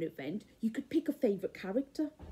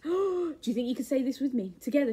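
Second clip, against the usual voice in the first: ordinary delivery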